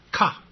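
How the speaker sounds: 卡 cut short with a puff of air; brief, breath-released 卡; short aspirated syllable. Shown as a demonstration. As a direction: aspirate 卡 and stop the vowel quickly. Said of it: "edge是代表短促音".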